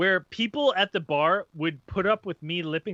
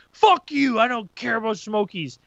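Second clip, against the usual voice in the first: heated; 4 semitones higher than usual. Retold or imitated. Stranger rejecting talk of Smokies, heated. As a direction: imitate a guy blowing up. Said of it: "getting heated".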